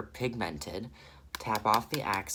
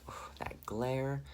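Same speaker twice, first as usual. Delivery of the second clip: whispered aside; 3 semitones higher, 7 dB quieter than usual. Real, not acted. Annoyed at the light glare.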